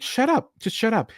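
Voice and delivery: high-pitched fed-up protest; exasperated. Retold or imitated. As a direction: cut off a bore, voice jumping up. Fed up with character reciting statistics.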